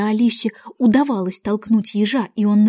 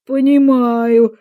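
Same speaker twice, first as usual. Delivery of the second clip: very slow, loud drawn-out word; loud exclamation; 2 semitones higher, 8 dB louder, much slower than usual. A narrator's reading. Queen inspecting the roses exclaims, loud and drawn out. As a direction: stretch the word out loudly. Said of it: "воскликнула королева".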